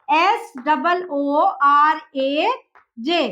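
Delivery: S double O R A spelled very slowly, letter by letter; slow spelling. Shown as a demonstration. Teacher spelling Suraj for students, very slowly letter by letter. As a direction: space out each letter clearly. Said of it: "इसकी spelling क्या कर देंगे".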